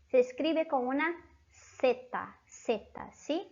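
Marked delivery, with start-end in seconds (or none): none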